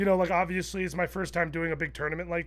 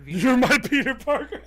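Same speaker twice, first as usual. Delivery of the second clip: laughing; 7 semitones higher, 4 dB louder than usual. Real, not acted. Echoing a friend's joke, laughing.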